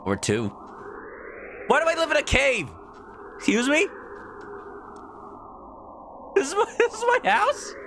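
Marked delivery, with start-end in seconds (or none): 1.7-7.7 high-pitched outraged disbelief; mock incredulous. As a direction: squeak out the questions in hammed-up shock. Acts character's shock at cave home.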